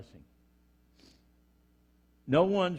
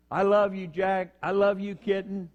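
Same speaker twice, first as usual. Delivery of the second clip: calling out; 5 semitones higher, 4 dB louder than usual. Retold or imitated. Retelling the couple's weekly call.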